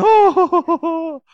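high laughing groan of misery; mock miserable groan. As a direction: fake a pitiful moan. Mock miserable groan while fasting.

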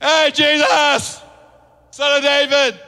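loud, high, drawn-out shout to someone passing; desperate yell for attention; calling out. Acted. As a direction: holler it across a crowd, stretching the words. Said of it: "call out".

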